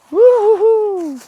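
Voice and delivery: very high loud whoop; high whooping call. Acted. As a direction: whoop to call them over. Calling foxes. (cri aigu pour appeler)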